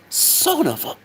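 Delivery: very high, drawn-out squeal of a voice; Troublesome Junction. Acted. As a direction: squeak it out slowly, breaking into laughter. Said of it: "Troublesome Junction would probably".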